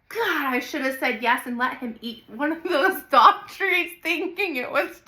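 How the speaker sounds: loud laughing through the words. Real, not acted. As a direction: laugh while you talk. Laughing while telling the story.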